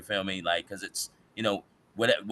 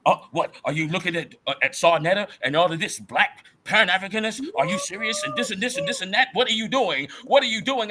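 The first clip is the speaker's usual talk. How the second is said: retold father's scolding, pitched high and louder; 11 semitones higher, 3 dB louder, faster than usual. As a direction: mimic a disbelieving dad scolding his son. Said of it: "He scolded me and said".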